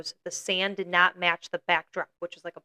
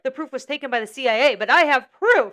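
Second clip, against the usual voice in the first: raised pitch, punching "proof" loudly; mock insistence; 6 semitones higher, 6 dB louder than usual. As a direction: imitate someone adamant, hitting "I have proof" hard. Acts out guest insisting on proof.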